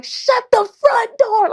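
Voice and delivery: high, drawn-out, loud exclamation; retold excited yell. Founder re-enacting her reaction to the award email, high excited yell. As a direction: re-enact yelling it out in amazement, stretching the words. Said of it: "I yelled out in the email".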